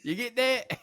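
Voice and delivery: put-on high laughing Southern accent; Southern drawl through laughter. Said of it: "and then a southern accent".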